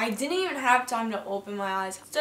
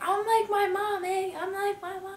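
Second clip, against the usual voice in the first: higher sneering parody of the friend; mocking echo; 8 semitones higher than usual. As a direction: throw her words back in a higher mocking voice.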